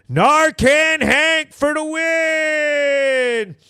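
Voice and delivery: high, long-drawn mock victory cry; comic champion's battle cry; triumphant slogan yell. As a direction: holler it like a slogan, stretching the end.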